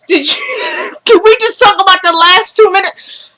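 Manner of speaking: high squealing laugh into disbelief; shocked laughing. Real, not acted. Host begs viewers to discuss the ending, high and giddy. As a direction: laugh it off, still reeling.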